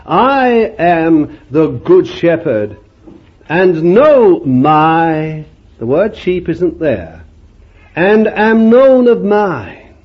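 preacher intoning the Good Shepherd verse, slow and loud; solemn scripture reading. Acted. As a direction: stretch the words out reverently. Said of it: "He talks like this".

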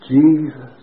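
retold outcry. Preacher at a sick man's bedside, retold loud cry. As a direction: call out loudly with sudden force. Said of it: "I suppose the whole ward heard".

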